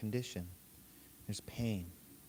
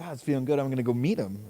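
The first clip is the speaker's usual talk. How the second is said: high, loud and pleased with himself; upbeat self-quote; 3 semitones higher, 8 dB louder, faster than usual. Retold or imitated. Proud of his haircut, heading to meet a friend: high, loud, chuffed. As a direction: sell how great the fresh haircut feels. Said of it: "And then I'm like".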